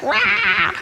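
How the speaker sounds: high, drawn-out "rah"; mock writhing cry. Imitating son writhing in the kitchen, mock frantic cry. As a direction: imitate a frantic squirming yelp. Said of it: "Taylor's writhing like a snake".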